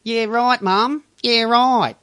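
a lightly done Kim whining at Mum; Kath and Kim. Retold or imitated. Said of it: "Kath and Kim".

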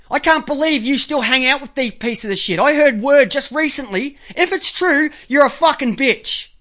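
ordinary delivery

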